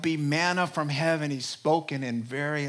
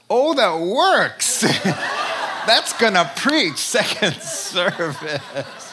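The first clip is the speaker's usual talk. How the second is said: laughing; 4 semitones higher, 9 dB louder, slower than usual. Real, not acted. Reacting, laughing.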